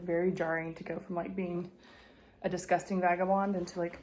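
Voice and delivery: usual speaking voice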